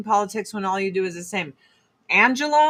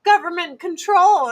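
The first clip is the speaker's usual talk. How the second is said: mock governor; 8 semitones higher, 4 dB louder than usual. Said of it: "He's like".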